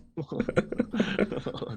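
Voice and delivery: high, airy burst of laughter; real laughing fit. Real, not acted. Host reacting to the co-host's milkshake joke, helpless laughter.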